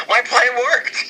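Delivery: hint of a scheming boss; lightly voiced gloat; smug villain. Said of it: "He's just like".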